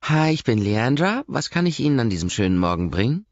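high, loud, fast chirpy server voice; bright sing-song welcome to customers; perky waitress. Acted. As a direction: gush the greeting like an overeager waitress. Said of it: "Wir werden unterbrochen".

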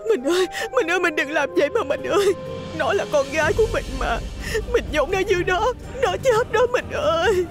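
very high, wailing wife; sobbing plea. A narrator's reading. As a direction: cry and beg your husband. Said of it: "Bà bò tới van xin".

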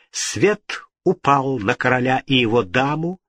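ordinary delivery, nothing marked

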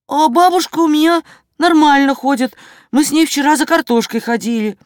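высокий голос Жорика, к концу скороговоркой; выложить правду по-детски высоко; честный мальчик. A narrator's reading. Мальчик оправдывается перед продавцом. (по-мальчишески высоко)

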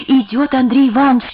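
high-pitched cry of warning; breathless alarmed cry. A narrator's reading. Girl rushing in to warn the household, breathless alarm. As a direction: call out in alarm, short of breath. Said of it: "крикнула она, задыхаясь".

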